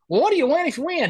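high, quick curious question; mock innocent Cox. Cox asking the stranger in a high, quick, innocent voice. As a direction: bait him with fake wonder.

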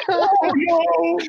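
high, hammed-up sobbing wail; imitated crying wail. Rider replaying the boy's cry for friends, imitated wail. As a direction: wail it like a panicked child. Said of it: "he's crying".

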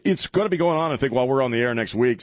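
ordinary talk, nothing marked

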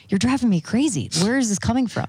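mock-complain in disbelief at his act; retold exasperation. Retold exasperation at husband's accent.

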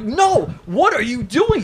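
high, startled retold "No!"; exasperated high yelp; alarmed protest. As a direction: stop a drunk friend in disbelief. Stopping friend blading in kitchen.

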